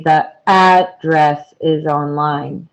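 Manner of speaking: "address" split as AD-dress, weight on AD; stressed first syllable. Shown as a demonstration. Teacher pointing out the noun "address", first syllable stressed. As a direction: punch the AD in "address", separating the syllables. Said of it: "notice that we say".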